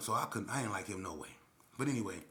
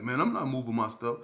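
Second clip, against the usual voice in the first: mock refusal; 4 semitones higher, 12 dB louder than usual. Retold quarrel.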